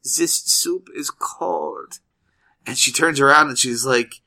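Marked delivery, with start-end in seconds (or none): 0.0-2.0 put-on slow, high, quiet child with a German accent; a prim kid speaking at last, mild German accent. Sick German boy complaining to his mother, slow prim voice. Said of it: "all of a sudden she hears".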